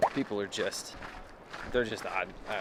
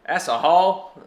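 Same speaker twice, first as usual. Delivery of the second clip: put-on Texas accent; 9 semitones higher, 13 dB louder, much slower than usual. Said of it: "I can't do a Texas accent".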